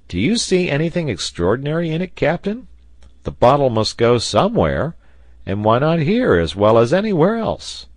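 high, animated sailor arguing; lively, insistent questioning; spirited challenge. A narrator's reading. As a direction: push back loudly, pitch leaping up. Sailor argues with the captain.

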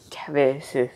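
unvoiced hushed whisper. Shown as a demonstration. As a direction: breathe the word out with no voice. Says the word twice, differently.

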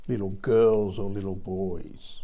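slow, oily sergeant's purr. Retold or imitated. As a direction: purr the question slyly, slowly. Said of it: "The sergeant said in an oily voice".

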